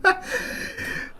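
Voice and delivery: silent, airy high wheeze of laughter; breathless laugh. Real, not acted. Gamer stunned by a brutal hit, wheezing silent laugh. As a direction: laugh with no voice at all, caught off guard. Shocked by a sudden kill.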